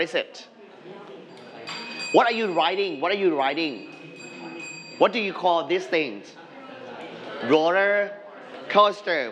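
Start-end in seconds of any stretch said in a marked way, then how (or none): none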